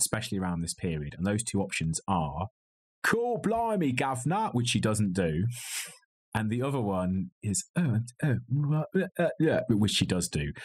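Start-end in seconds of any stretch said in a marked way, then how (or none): 3.0-4.5 high, hammed-up Cockney caricature; exaggerated stage Cockney, pitched up; mock Cockney accent. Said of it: "an English accent". 7.8-9.6 put-on hesitant, plummy posh-lady accent; posh English flustering, pitched up. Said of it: "she goes for posh lady".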